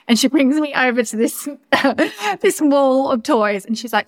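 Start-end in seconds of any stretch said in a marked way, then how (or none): 1.5-2.3 high giggling laugh that breaks the sentence; burst of laughter. Real, not acted. Telling friends about the toy wall, real high laugh.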